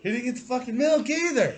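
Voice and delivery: high, loud snitching yell; tattling shout. Retold or imitated. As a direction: loudly tell on him. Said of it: "I said".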